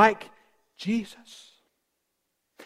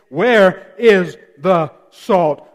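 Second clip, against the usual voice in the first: slow emphatic question; 5 dB louder, much slower than usual. Acted.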